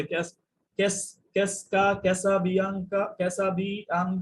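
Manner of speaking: stumbling over ক্যাসাবিয়াঙ্কা, restarting ক্যাস- again and again; halting mispronunciation. Retold or imitated. Teacher mimicking a frightened learner stumbling over ক্যাসাবিয়াঙ্কা. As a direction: sound out ক্যাসাবিয়াঙ্কা like a learner who keeps tripping. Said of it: "এভাবে উচ্চারণ করতে থাকে".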